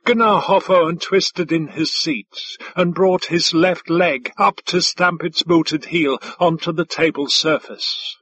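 ordinary delivery, nothing marked

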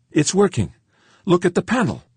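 high, loud insistence; insistent shout. A narrator's reading. Crewman pointing George to panel, loud and insistent. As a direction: call it out with excitement.

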